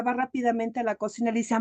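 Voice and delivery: usual speaking voice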